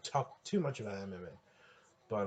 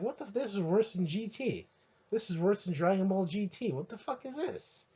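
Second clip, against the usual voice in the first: higher mock outrage, rising to a squeak at the end; mad fan; 9 semitones higher, 3 dB louder than usual. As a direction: play a furious fan who can't believe it. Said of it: "You'd be mad as shit".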